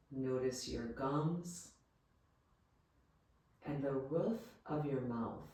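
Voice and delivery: soft, calm Yoga Nidra guidance with long pauses; guided meditation. Real, not acted. Yoga teacher leading listeners into rest, guided meditation voice. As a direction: guide them gently, leaving space after each phrase.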